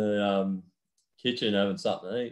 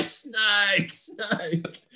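mock scream; 9 semitones higher than usual. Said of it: "Comes screaming".

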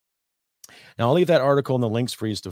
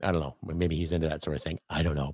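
usual speaking voice

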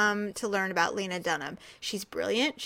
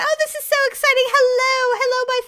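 much higher and much louder, gushing with glee; over-excited celebrity greeting; 11 dB louder than usual. Retold or imitated. Playing an overexcited star on the red carpet, squealing hellos. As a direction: gush hello to the crowd like a thrilled starlet. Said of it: "Be like".

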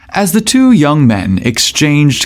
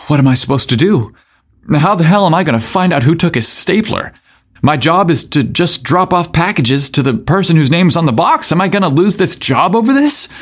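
higher, fast panicky rambling; panicked; 5 semitones higher than usual. Acted. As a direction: spiral into worried panic. Derek panicking alone after scolding.

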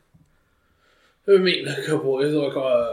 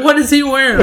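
high, loud appalled question; shocked mom; 11 semitones higher, 9 dB louder than usual. Retold or imitated. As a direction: mimic mom's horror at the photo. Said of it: "she was like".

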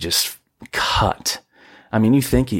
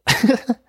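laugh; 13 semitones higher than usual. Real, not acted. Laughing at own kid voice.

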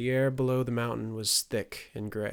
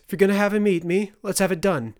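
higher, louder, fast and reckless; mother daring her son to set the wolf on her; defiant outburst; 10 semitones higher, 10 dB louder, faster than usual. Acted. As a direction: dare him to do it, voice rising high.

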